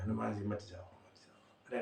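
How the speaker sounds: soft, urgent plea fading to a whisper; hushed pleading. Acted. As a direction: beg him quietly to stop. Brahma begs Hanuman not go.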